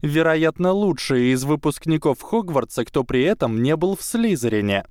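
high, eager schoolgirl; bright proud young witch; girl voice. A narrator's reading. Tracey explains eagerly.

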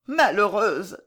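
plain reading voice

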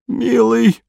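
high, moaning plea; hoarse groan. A narrator's reading. Sinking man to his rescuer, hoarse pleading groan. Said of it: "хрипло простонал он".

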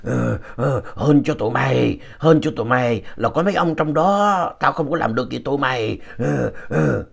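growled threat from a possessed woman; possessed, growling woman. Retold or imitated. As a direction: imitate a spirit snarling through her, growls between phrases. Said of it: "Bà cứ gầm gừ".

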